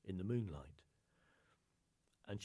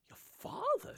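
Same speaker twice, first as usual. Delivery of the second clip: much higher, louder shocked question; 5 dB louder, slower than usual. Acted. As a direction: squeak it out in disbelief. Angus shocked at seal's daughter.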